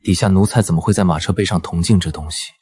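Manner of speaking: understated sardonic reassurance; snide insinuation. Acted. Man telling the woman nothing shows, mildly snide. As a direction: reassure with a faint sly edge. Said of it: "你别阴阳怪气".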